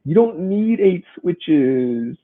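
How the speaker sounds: hammed-up whine, high and drawn out; whiny crying voice. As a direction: mimic a crying kid's slow, high-pitched wail. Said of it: "in the whiny crying voice".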